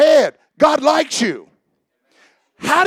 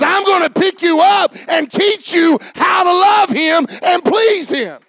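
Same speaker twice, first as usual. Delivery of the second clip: higher, lifted voice of grace speaking; preacher voicing grace, higher and fervent; 6 semitones higher than usual. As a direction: let grace promise it with joy.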